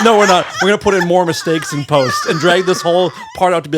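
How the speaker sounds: loud, high, fast refusal; gleeful protest. Real, not acted. Host refusing to fix it in post, loudly teasing. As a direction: pile it on loudly over the laughter.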